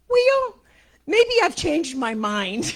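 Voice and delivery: squeaky, loud, stretched retort; high startled quip. Retold or imitated. To her minister, a sudden high and loud change of heart. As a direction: jump up high and loud, then slow down.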